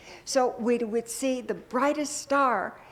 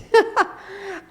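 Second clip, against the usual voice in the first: a high, loud burst of real laughter; 11 semitones higher, 6 dB louder than usual. Real, not acted. Minister laughing aloud to the congregation about a terrible exercise.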